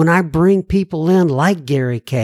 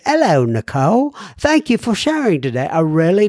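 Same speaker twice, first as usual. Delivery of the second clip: put-on Australian accent that slips into British; attempted Australian accent. Host greeting a woman after a meeting, botched Australian accent. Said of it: "That's not an Australian accent. That's a British accent".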